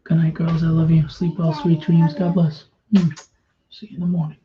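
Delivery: soft, gentle goodnight to his daughters; soft, loving goodnight; tender bedtime goodnight. Real, not acted.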